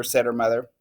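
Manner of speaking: ordinary delivery, nothing marked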